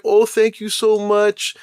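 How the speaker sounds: mock a sweetly delighted mom; lightly voiced gushing mother; grateful mother. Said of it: "the mother was like".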